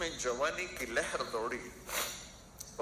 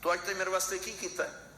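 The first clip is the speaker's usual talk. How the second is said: higher, faster accusing son; rude son's demand; 7 semitones higher, faster than usual. Retold or imitated. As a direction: fire it off like an ungrateful son. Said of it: "ایک بچہ اپنے باپ سے کہہ رہا تھا".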